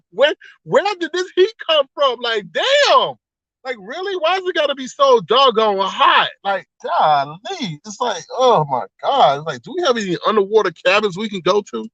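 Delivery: high, swooping exasperation; exasperated. Real, not acted. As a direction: complain about the heat in big, disbelieving swoops of pitch. Exasperated rant about the heat.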